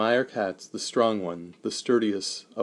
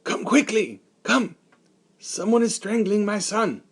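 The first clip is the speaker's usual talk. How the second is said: high, loud desperate calling; urgent call for help; 11 semitones higher, 5 dB louder than usual. Acted. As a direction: shout for help across the wagon. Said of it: "he thought of calling Meyer Katz".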